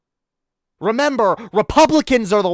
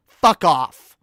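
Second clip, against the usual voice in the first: louder, final fuck off; louder repeat; 6 dB louder than usual. Real, not acted. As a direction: slam it down the second time.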